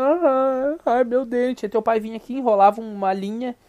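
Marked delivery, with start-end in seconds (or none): 0.0-1.6 higher, louder mock wail; whiny kid. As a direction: whine like a kid with a sore tooth. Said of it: "a gente ficava que nem umas bichonas".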